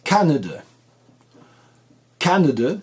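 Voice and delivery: Canada punched high on CAN; first-syllable stress. Shown as a demonstration. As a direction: hit CAN in Canada, two weak syllables after. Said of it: "ça s'accentue sur la première".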